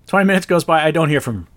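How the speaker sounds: normal talking voice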